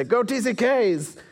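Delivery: high, slow, drawn-out cheer; rally the crowd, tongue in cheek; mock rally cheer. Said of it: "put on a flag and say".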